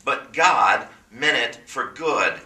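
"But God" drawn out slowly, God leaned on hard; slow down and land on "God" with weight; stress on but God. Shown as a demonstration. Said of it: "See where it says, "But God"?".

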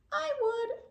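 a tiny high squeak; high squeaky snail. A narrator's reading. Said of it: "offered one of the snails".

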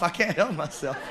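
ordinary talk

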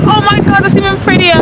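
high excited gasp; excited squeal. Retold or imitated. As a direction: squeal it with delight. Quoting own bigger reaction.

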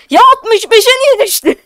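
loud, high-pitched disbelief; exasperated outcry. Real, not acted. Streamer seeing the count fall to sixty-five, exasperated. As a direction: cry out in dismay at the drop.

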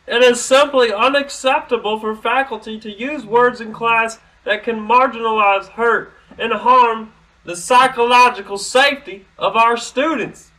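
high, loud prissy read of the email; mock official voice. Mocking the dean's email to students, high and loud.

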